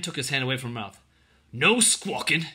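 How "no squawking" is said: louder, higher warning. A narrator's reading. Soldier to the girl he just let go, warning. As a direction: warn a captive to keep still. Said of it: "he warned her".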